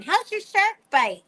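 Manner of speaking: high, eager voice for the family; playing the family asking about the pretend shark bite, high and eager; mock curious asking. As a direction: pitch it up like a nosy relative. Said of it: "you guys would be going".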